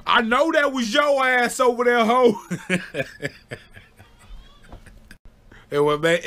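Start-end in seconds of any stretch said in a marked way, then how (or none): 0.1-2.4 high, mock-furious mom voice; imitating angry mom. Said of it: "She said". 2.4-3.3 short breathy laugh. Real, not acted. Laughing at own story.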